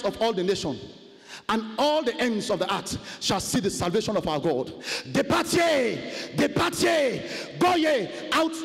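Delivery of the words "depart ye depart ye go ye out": proclaimed scripture. Acted. Preacher shouting scripture at the congregation. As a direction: thunder the verse at them.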